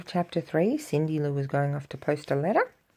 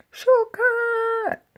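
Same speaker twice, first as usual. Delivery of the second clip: quick excited call; 5 dB louder than usual. Acted. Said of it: "making a quick decision".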